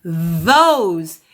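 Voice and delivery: those with a long voiced th; buzzing those. Shown as a demonstration. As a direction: hum the th in those. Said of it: "Your voice is vibrating again".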